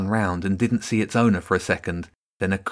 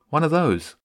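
nonchalant; 6 semitones higher than usual. A narrator's reading. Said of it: "he said nonchalantly".

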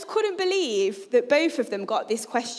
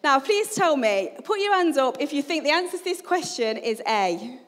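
ordinary talk, nothing marked

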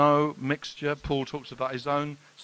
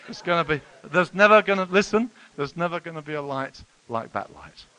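much louder, urgent preaching; forceful warning; 4 semitones higher, 11 dB louder than usual. Real, not acted. Preacher warning the church about standing before God, much louder. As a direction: drive it home to the congregation. Preacher warns of judgment day.

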